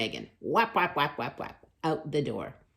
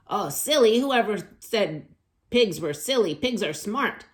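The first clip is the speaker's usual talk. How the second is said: defiant; 7 semitones higher, 8 dB louder than usual. Acted. Megan answers teacher defiantly.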